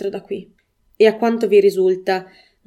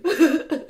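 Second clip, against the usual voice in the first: sob; 8 semitones higher than usual. A narrator's reading. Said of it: "scossa dai singhiozzi".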